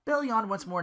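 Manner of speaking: ordinary delivery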